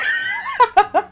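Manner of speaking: high burst of giggles; amused laughing. Real, not acted. Streamer amused by his friend's slip, laughing. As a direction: burst out laughing at your own punchline.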